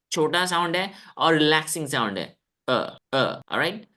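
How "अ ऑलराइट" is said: ordinary talk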